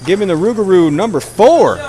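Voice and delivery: lively hockey commentary; play-by-play. Acted. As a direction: call the game as it happens.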